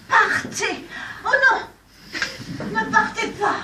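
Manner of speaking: high, loud, torn between go and stay; shouted dismissal. Acted. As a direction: fling it at him, then take it back. She sends him away, then again. (crié, haut et fort, hésitante)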